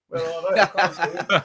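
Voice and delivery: high, laughing mock panic; mimicking Dave panicking. Mocking Dave looking behind on the scooter, high laughing. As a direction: squeak it out like a nervous passenger, laughing. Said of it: "he was going like that".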